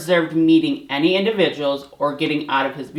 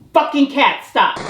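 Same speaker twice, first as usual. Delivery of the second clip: high, loud angry scolding; real fury at the cats for interrupting; angry yell; 11 semitones higher, 6 dB louder than usual. Real, not acted. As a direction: vent at the cats in real anger.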